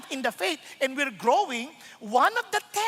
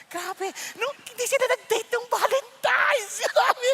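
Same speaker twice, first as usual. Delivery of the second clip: excited gossip; 9 semitones higher, slower than usual. Retold or imitated. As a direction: savor the juicy news. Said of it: "gossip imitation".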